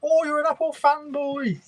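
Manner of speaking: very high, drawn-out mimicking voice; mocking critics. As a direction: whine it like a silly taunting heckler. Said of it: "I don't care what anybody says".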